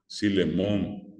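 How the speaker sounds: Silemón said slowly with S for F; slow mispronounced name. Shown as a demonstration. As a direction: model Silemón as a learner says it. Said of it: "siambre o siambrera".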